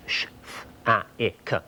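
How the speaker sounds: "sh, f, a, I, k" as separate short phonemes; isolated speech sounds. Shown as a demonstration. Presenter showing viewers the component sounds of speech, "sh, f, a, I, k" sounded out separately. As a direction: sound out "sh", "f", "a", "I", "k" one by one. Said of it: "component sounds of speech".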